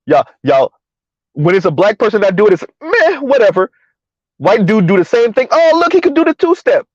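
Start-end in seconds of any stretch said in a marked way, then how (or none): 2.8-3.7 high-pitched dismissive scoff; mock dismissive. Acting out a dismissive reaction. 5.5-6.9 high-pitched, gushing fake wonder; mock amazement.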